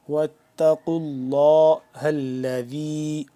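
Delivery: اللہ with a full, thick لام; heavy Allah. Shown as a demonstration. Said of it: "نرمی سے پڑھیں گے دال کو".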